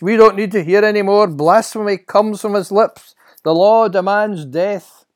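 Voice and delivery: loud, high furious verdict; outraged high priest. Acted. High priest condemning the blasphemer to death, furious. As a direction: condemn at full voice.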